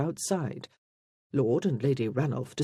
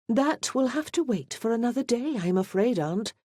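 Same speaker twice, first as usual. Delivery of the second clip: higher-pitched, politely firm lady; apologetic countess; 8 semitones higher than usual. A narrator's reading. Countess excuses Miss Hutchinson to her aunt, politely apologetic. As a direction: decline gently but firmly, lightly voiced countess. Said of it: "smiling apologetically at both the Marchioness and the Duke".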